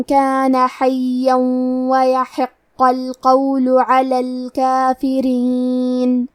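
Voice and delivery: slow, melodic tajweed recitation; Quran recitation. Acted. Teacher reciting the verses in full for students. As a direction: recite slowly, drawing out the long vowels.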